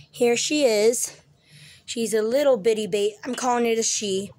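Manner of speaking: normal talking voice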